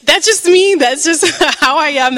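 bursts into high laughter mid-sentence; laughing at herself. Real, not acted. Sharing her vision, laughing at her own questions. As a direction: laugh it out, amused at your own nosiness.

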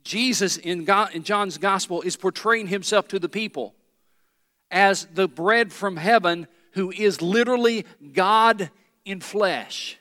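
ordinary delivery

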